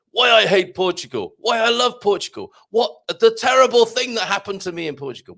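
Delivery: high, loud mock-sensational titles; clickbait headlines. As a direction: hype them as outrageous video titles. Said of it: "can you imagine the clickbait titles".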